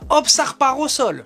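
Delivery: high, quick fight commentary; excited play-by-play. Acted. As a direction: call the action as it happens.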